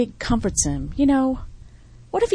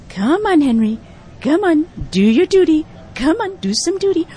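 talking to a dog; 4 semitones higher, 5 dB louder than usual. Acted. Coaxing dog to poop.

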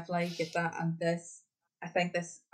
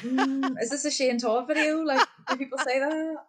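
higher-pitched, amused incredulity; laughing disbelief; 8 semitones higher, 4 dB louder than usual. Retold or imitated. Viewer mocking scripted local slang, laughing in disbelief. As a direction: question it through laughter, pitched up.

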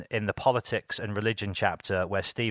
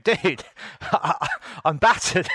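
laughing; 9 semitones higher, slower than usual. Retold or imitated. Retelling being very high.

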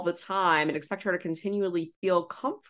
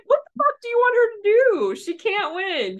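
much higher, louder exasperated yell; outraged shout; 13 semitones higher, 7 dB louder than usual. Retold or imitated. Podcaster yelling at Nicole on TV, high and loud. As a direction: shout in disbelief at the screen.

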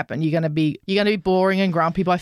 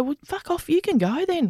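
mock dismissive partner; 8 semitones higher than usual. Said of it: "you go".

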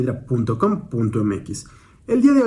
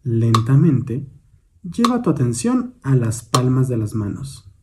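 calm, measured meditation guidance with long pauses; guided meditation. Real, not acted. Instructor guiding a listener's attention to the hands, meditative. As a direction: guide gently, leaving space after each prompt.

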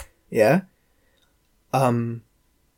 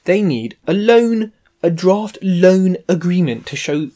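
slow, louder mock Welsh accent; bad Welsh accent; 4 semitones higher, 4 dB louder, slower than usual. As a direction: draw out a clumsy Welsh solicitor's accent. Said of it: "that Welsh accent".

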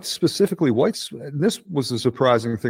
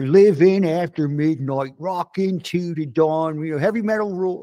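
raised, exaggerated Philly-Baltimore accent; Maryland metalhead accent; 6 semitones higher, 3 dB louder than usual. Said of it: "it's the same fucking accent".

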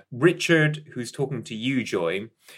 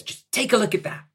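higher, gleeful sales pitch; barely suppressed delight; 12 semitones higher than usual. Acted. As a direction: hold back a grin as you show it off. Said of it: "barely suppressed delight".

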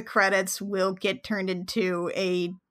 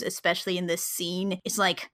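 normal talking voice

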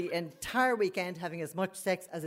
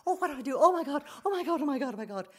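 high-pitched frantic muttering to self; hammed-up terror, spiralling through the repeats; acted panic; 8 semitones higher, faster than usual. Recognising her mother's voice outside the cubicle, high mock panic.

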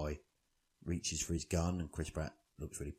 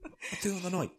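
high, exaggerated cocky boast; cocky mimic; 13 semitones higher, faster than usual. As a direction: ham up the boast. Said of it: "I was like".

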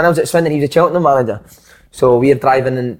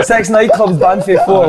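higher, louder, slow laughing repeat; 7 semitones higher, 5 dB louder, slower than usual. Real, not acted. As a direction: repeat it in disbelief while laughing. Teller repeats boast, laughing.

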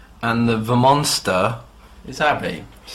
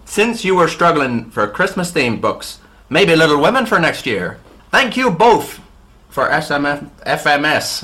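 higher, hammed-up Northern Irish accent; Ulster accent; 6 semitones higher, 5 dB louder than usual. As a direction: read on in a mock Ulster accent, pitched up. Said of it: "I'll try just Ulster".

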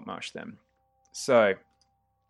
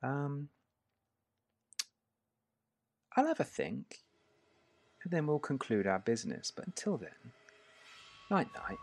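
hushed, soft sinister goodbye; soft menace; 3 semitones higher, 9 dB quieter than usual. Acted. Bald man bidding the prisoner a soft sinister night-night. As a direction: purr the threat quietly before the blow.

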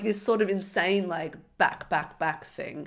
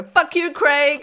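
yelling it high and loud; angry shout; 9 semitones higher, 9 dB louder, slower than usual. Retold or imitated. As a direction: spit it out at him furiously. Quoting Maxine's outburst.

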